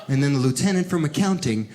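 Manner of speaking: ordinary talk, nothing marked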